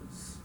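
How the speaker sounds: "S" as a voiceless hiss, not the letter name; hissed S sound. Shown as a demonstration. Teacher isolating the normal S sound, a voiceless hiss. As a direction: let "S" out as a plain hissing consonant. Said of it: "like a normal letter S".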